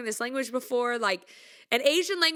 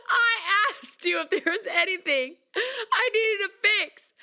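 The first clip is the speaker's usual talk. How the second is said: high, hammed-up wounded plea; mock wailing; 6 semitones higher, slower than usual. Singer to the producers who let her down, mock wailing. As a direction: wail in mock betrayal, half laughing.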